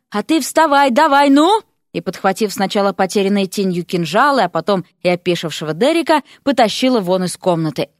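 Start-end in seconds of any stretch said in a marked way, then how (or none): 0.1-1.6 higher, louder hurry-up command; impatient urging. A narrator's reading. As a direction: chivvy him along impatiently. Вася торопит, гонит Дерека. (нетерпеливо подгоняя)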